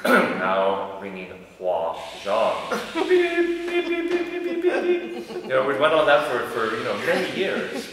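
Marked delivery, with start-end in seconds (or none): 0.0-2.5 slow, stiff automated phone voice; computer voice. Retold or imitated. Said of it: "the voice would say". 2.9-5.4 very high, loud string of beeps; repeated beeps. Retold or imitated. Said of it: "the voice would say".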